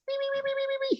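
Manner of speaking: very high, soft wee wee squeal. Retold or imitated. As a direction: squeak like a spinning cartoon mummy. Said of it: "And the mummy goes".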